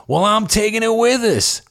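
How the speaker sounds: high mock seller voice; imitating the seller. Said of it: "the seller would say".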